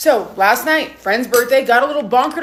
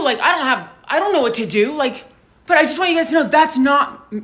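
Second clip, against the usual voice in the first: normal talking voice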